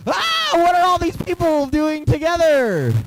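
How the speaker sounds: high, slow, loud olden ghost; a puzzled sixteenth-century ghost. Pretend. Ghosts from the fifteen hundreds puzzling at the crowd, high slow voice. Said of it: "they're like".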